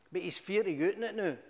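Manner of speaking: usual speaking voice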